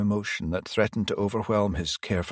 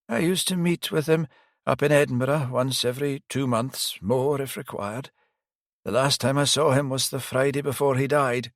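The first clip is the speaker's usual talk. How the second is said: put-on higher old priest voice, rueful; elderly priest; 6 semitones higher than usual. Priest admitting he failed the detective, higher pitched.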